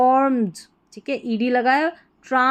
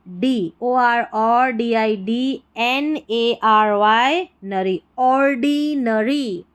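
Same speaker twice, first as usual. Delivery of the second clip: slow spelled-out ordinary; spelling it out slowly; much slower than usual. Shown as a demonstration. Teacher spelling ordinary slowly, letter by letter. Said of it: "ऐसे तोड़ के पढ़ना है".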